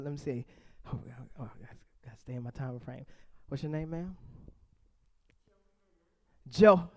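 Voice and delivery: ordinary talk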